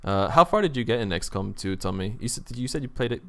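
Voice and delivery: ordinary talk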